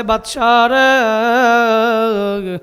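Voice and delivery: long, drawn-out sung call. Acted. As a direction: call to the king, stretching the last syllable. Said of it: "ডাক দিয়া বলে". (সুর করে টেনে ডাক)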